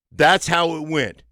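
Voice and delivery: ordinary talk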